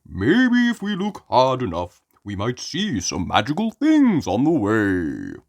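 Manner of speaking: put-on a cheerful dad humouring the kids; Daddy Pig voice. Daddy Pig encourages Peppa, jolly dad voice.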